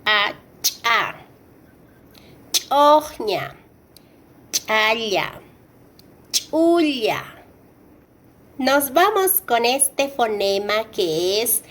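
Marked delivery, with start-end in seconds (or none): 0.6-7.2 "cha", "Choña", "Chaya", "Chulla" with a popped glottal CH; "cha", "Choña", "Chaya", "Chulla" as ch', a sharp tick before the vowel; ejective CH. Shown as a demonstration. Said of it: "último fonema de este grupo".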